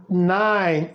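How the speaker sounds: ordinary talk, nothing marked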